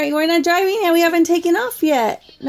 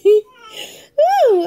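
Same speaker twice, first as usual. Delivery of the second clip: high laughing whoop; laughing woo; 4 semitones higher than usual. Real, not acted. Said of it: "I can't help but laugh".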